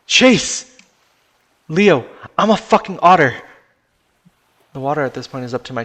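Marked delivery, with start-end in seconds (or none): 0.1-0.8 high, very loud shout; desperate yell. Acted. Friend protests as Chase stays behind, desperate yell. 1.7-3.4 high, very loud retort; exasperated shout. Acted. Chase argues back in the sinking car, exasperated shout.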